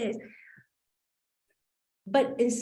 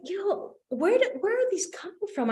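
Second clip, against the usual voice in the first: high, fast baffled outburst; surprised by the words coming out of her; 10 semitones higher than usual. Retold or imitated. Startled by her own answers to the coach, high and fast.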